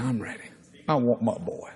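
low, soft mother's reply; hushed resolve. Retold or imitated. Mother answers preacher beside her son, low hushed resolve. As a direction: drop low and quiet, fully determined.

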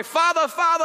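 high, loud calling to the father; crying out. Acted. Believer crying to the Father, high loud call. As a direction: call out high like a longing son. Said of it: "so he can cry".

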